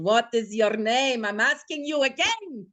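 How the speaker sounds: put-on slow Russian accent, rising to an insistent finish; Russian barmaid drawing out each question, then pressing. Bartender demonstrates her line for the skit, Russian accent. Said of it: "maybe mine would be more real".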